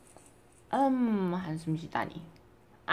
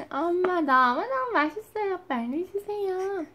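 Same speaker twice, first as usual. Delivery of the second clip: put-on higher, babyish plea; voicing the baby; 6 semitones higher than usual. Mother speaking as her baby, higher, begging for food. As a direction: ask for food as the baby would.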